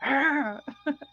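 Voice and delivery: short playful mock growl; animal growl. Shown as a demonstration. Showing listeners how often we snarl at home, mock growl. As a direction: growl like an animal, half-joking. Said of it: "quantas vezes a gente rosna".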